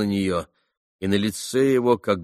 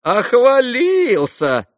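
high, loud, drawn-out yell; mock him with a long loud cry; shouted jeer; 9 dB louder, much slower than usual. A narrator's reading. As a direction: Rakitin jeering at Alyosha, loud and high. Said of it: "крикнул Ракитин".

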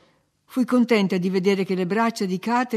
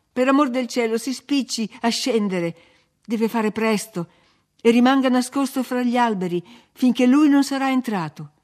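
louder, higher urgent warning; urgent plea; 4 semitones higher, 6 dB louder than usual. A narrator's reading. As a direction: beg him to hurry and hide.

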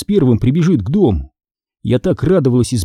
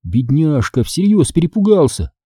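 ordinary delivery, nothing marked

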